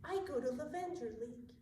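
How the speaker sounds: soft, sultry perfume commercial voice. Pretend. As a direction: purr it like a perfume ad. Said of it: "It just sounds like you're in a perfume commercial".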